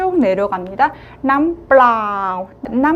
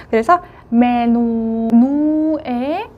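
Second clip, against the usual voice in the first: 메누 with 누 stretched long and rising; stress on 누; much slower than usual. Shown as a demonstration. Teacher shows the stress on the last syllable, slow. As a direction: drag out the 누 of 메누. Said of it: "강세가 좀 가죠".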